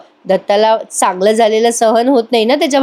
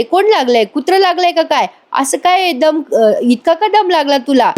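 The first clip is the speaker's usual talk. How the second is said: higher-pitched questioning aunt; lightly voiced aunt, pitched up; puzzled aunt; 6 semitones higher than usual. A narrator's reading. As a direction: ask in a raised, puzzled voice. Aunt questioning her breathless brother in story.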